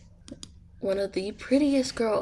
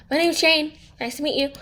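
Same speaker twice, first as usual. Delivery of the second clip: sudden, higher and louder introduction; abrupt introduction; 6 semitones higher, 5 dB louder, much faster than usual. Acted. Jane suddenly tells staring Max her name, loud and abrupt. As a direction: blurt the name out of nowhere.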